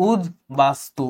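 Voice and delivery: উদ বাস্তু with the ত turned into a d and the ব sounded, 'ud-bastu'; ud-bastu. Shown as a demonstration. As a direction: sound the b clearly after 'ud' in উদ বাস্তু. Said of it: "দয় change হয়ে গেছে".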